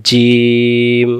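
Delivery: জিম stretched very long; slow drawn-out jim. Shown as a demonstration. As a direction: hold জিম slowly. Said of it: "আবার বলেন". (জিম খুব টেনে লম্বা করে)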